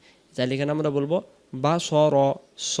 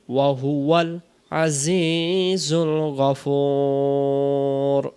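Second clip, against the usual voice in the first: slow, drawn-out tajweed chant; Quran recitation; much slower than usual. Shown as a demonstration. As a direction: recite it like a qari, very slowly. Recites full verse. (ধীর তেলাওয়াতের সুরে)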